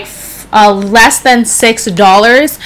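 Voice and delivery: ordinary talk, nothing marked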